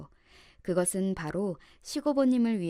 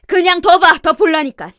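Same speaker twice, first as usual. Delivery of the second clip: loud, raised, firm elder voice; forceful elder cutting in; commanding aunt-in-law; 8 semitones higher, 18 dB louder than usual. A narrator's reading. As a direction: overrule the shouting with a loud, high order. Aunt overrules the screaming sister-in-law.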